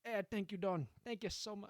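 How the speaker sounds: put-on grateful underling, failed Italian accent; attempted Italian accent. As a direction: grovel to the Don in a shaky-attempt Italian accent. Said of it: "I can't do an Italian accent".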